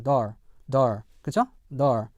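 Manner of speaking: American door with a clear final R. Shown as a demonstration. Teacher models for learners, American rhotic door. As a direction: close door on an R, American style. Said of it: "일반 미국 영어에서는요".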